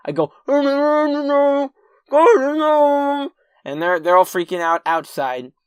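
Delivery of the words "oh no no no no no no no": high, loud, drawn-out muffled wail; muffled panicked moaning. Retold or imitated. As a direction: wail as if your mouth is taped over. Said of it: "I lick my, my thing off".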